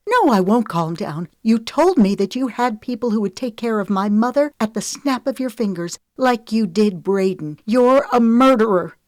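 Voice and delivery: furious. A narrator's reading. Lemon refusing to calm down, furious and loud. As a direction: throw every word at him in fury. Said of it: "she hissed".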